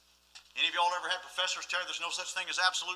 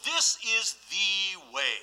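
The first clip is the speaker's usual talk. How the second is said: slow solemn refrain; much slower than usual. Acted.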